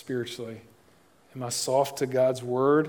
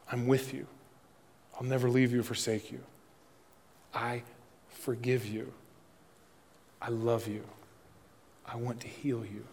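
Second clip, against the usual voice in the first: softer, tender promises with some breath in them; gentle voice of Jesus; 6 dB quieter than usual. Acted. Pastor voicing Jesus' promises to the congregation, soft and tender. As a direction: speak each promise gently to one listener.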